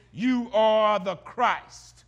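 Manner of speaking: slow, loud, every word weighed; emphatic declaration. Retold or imitated. Peter confessing to Jesus, slow emphatic proclamation. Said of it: "Peter says emphatically".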